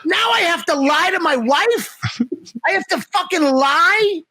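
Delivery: high, aghast at having to lie; incredulous mock outrage. To his friend, aghast at lying to his wife. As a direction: retell the shock of being asked to lie. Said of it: "I'm like".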